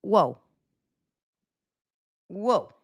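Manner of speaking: ordinary talk, nothing marked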